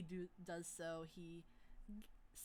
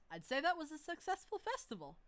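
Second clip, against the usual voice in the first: Hoth's voice; 10 semitones higher, 4 dB louder than usual. Acted. GM voices NPC Hoth.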